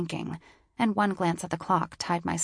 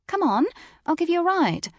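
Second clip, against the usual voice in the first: British woman voice; 11 semitones higher, 4 dB louder than usual. A narrator's reading. British woman offers ride.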